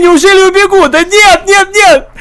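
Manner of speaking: high panicked cries; frantic, voice jumping up. Real, not acted. Panicked player losing a chase.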